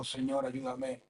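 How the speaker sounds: súplica suave. A narrator's reading. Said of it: "le suplicó".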